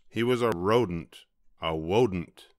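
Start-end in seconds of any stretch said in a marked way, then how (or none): 1.6-2.5 "wodent" with W in place of R. A narrator's reading. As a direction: say "wodent", the R turned to W. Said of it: "couldn't pronounce his R's".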